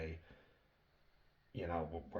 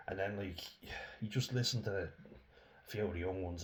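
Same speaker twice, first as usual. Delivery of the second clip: ordinary talk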